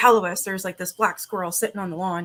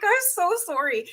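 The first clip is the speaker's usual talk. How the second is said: much higher sorry voice; apologetic high voice; 10 semitones higher, 3 dB louder than usual. Retold or imitated. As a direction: apologize to the dog sweetly. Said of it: "I'm like".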